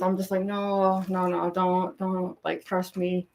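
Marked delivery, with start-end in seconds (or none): none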